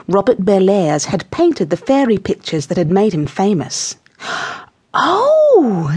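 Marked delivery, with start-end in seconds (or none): 4.9-5.9 high, drawn-out surprised oh; delighted surprise, pitched right up; exclaiming. A narrator's reading. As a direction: exclaim with a long high note. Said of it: "she exclaimed".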